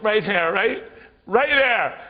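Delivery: high, loud mock-panicked directions; panicked shouting. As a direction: yell it out to the speeding driver. Shouting directions at speeding driver.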